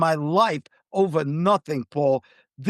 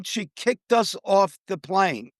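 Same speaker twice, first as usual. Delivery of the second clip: ordinary talk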